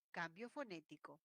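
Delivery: ordinary talk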